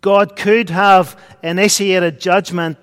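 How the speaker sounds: ordinary delivery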